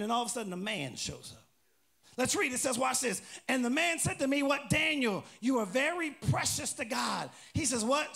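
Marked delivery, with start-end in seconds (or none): 3.5-7.3 high, loud preacher's proclamation; emphatic pulpit reading; scripture reading. Acted. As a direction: call the verse out to the room. Reads scripture aloud.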